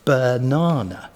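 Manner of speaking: Banana said slowly, weak-STRONG-weak; slow amphibrach Banana; stress on middle syllable. Shown as a demonstration. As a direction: lean on the NA of Banana. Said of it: "an arm on both sides".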